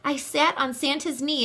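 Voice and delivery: plain reading voice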